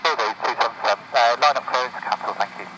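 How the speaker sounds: pilot radio. Real, not acted. Pilot reading back over radio.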